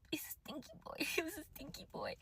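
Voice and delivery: unvoiced whisper breaking into a laugh; conspiratorial whisper with a giggle; whispered aside. Real, not acted. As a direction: lean in and whisper the secret, then laugh. Aside about the boy's smell.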